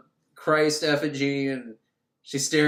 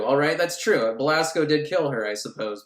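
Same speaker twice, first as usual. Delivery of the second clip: usual speaking voice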